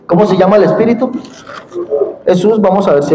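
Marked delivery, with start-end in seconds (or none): none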